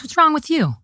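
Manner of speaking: high-pitched retold question; mock concerned question. Eric asking what's wrong, mock concern. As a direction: voice him asking, pitched well up.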